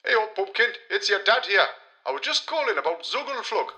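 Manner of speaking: fatherly phone call; dad on phone. Acted. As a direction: voice over the phone.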